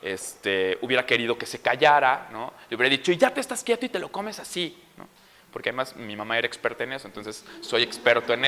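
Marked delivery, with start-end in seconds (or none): 3.1-4.8 mock scolding parent, higher pitch; enojo imitado. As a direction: order a child as if fed up. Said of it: "dejado llevar por el enojo". (regaño de papá enojado, imitado)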